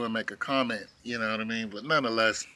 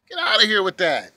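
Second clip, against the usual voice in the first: scoffing; 5 semitones higher, 7 dB louder than usual. Real, not acted. Scoffing at distraction.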